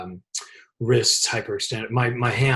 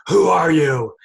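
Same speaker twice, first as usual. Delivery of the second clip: loud, higher, indignant imitation; retold angry challenge, raised voice; pissed-off demand; 4 semitones higher, 8 dB louder, slower than usual. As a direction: demand it like an outraged executive. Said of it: "she's all pissed off".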